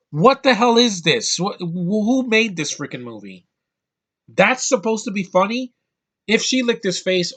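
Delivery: loud, high disbelieving outburst; real exasperated yell, high and loud; outraged. Real, not acted. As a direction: shout your disbelief at the screen. Outraged at the movie's joke.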